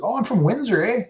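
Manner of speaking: ask-back with a Canadian "eh", lightly played; Canadian accent. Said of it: "super Canadian accent".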